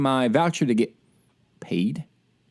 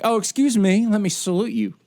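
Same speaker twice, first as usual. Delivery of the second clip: higher, sarcastic fake courtesy; mock politeness; 6 semitones higher than usual. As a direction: sneer a fake polite salute. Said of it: "and be like".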